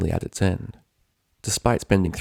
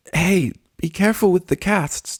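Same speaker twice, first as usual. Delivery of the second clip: much higher, strained words squeezed out; gasped; 11 semitones higher than usual. A narrator's reading. Dash crushed in Pinkie's hug, gasping about her casts. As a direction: gasp it out while being hugged too tight. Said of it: "Dash gasped through the ferocious constriction".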